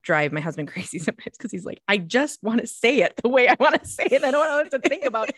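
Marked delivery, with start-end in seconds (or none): 1.9-5.3 retold complaint.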